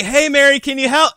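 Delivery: high, loud call for help. Retold or imitated. Calling to friend's mom for help.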